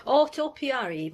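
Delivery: usual speaking voice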